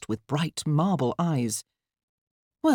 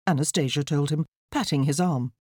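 ordinary delivery, nothing marked

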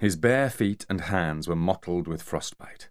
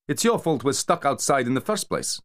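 higher pitched, accusing partner; Kamar, higher blaming voice; 8 semitones higher, 3 dB louder than usual. Acted. Kamar blaming his partner for the cold, pitched-up reproach. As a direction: blame him, voice pitched up.

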